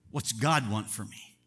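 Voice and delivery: ordinary delivery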